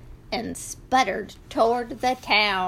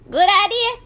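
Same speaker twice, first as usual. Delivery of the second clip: goat voice; 10 semitones higher, 6 dB louder, much faster than usual. A narrator's reading.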